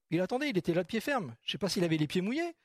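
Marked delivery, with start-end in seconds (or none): none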